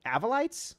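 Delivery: "Avolites" as AV-uh-lites, climbing high; puzzled high rise on "Avolites", opening AV; short-a pronunciation. Shown as a demonstration. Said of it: "four different ways that you can".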